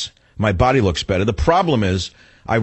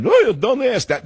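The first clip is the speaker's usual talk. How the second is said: high, slow Yiddish-accented "don't ask"; 13 semitones higher, 3 dB louder, slower than usual. Shown as a demonstration.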